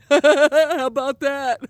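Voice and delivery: high laughter around the words; laughing. Real, not acted. Scoring a point about best friends, laughing.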